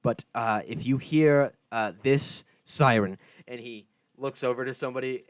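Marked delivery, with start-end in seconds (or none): 0.0-3.2 a booming ship captain addressing the passengers; loud captain. Acted. Captain warning the civilians about invasions, booming. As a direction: project the warning over the crowd. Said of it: "he does actually talk pretty loud".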